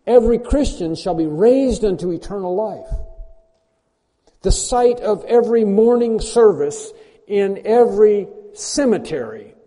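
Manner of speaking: loud, high fervent preaching; preaching at full force. Real, not acted. Preacher rousing his congregation, loud fervent proclamation. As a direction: proclaim it like a trumpet call.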